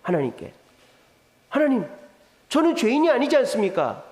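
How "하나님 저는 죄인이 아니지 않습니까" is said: higher-pitched, insistent appeal to God; acted indignant challenge, rising in pitch; confrontational protest. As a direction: argue your innocence to God. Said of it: "따지는 거예요, 하나님께".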